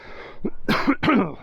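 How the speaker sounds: sudden choking cough; just swallowed a bug. Real, not acted. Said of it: "I've just swallowed a bug".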